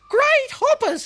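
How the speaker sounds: a tiny creature fussing grandly; chirpy cricket. A narrator's reading. Cricket stopping Blinky on the path, very high and squeaky.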